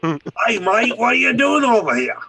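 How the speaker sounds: high, loud mock Aussie greeting; a hammed-up Australian accent. Mocking how the friend would sound back in the States, in an Australian accent. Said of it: "that Australian slang and American accent".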